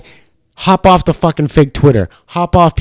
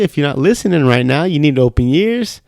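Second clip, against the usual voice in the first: normal talking voice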